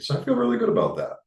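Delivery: real delighted rush. Real, not acted. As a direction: let the joy lift the voice right up. Happy about renting.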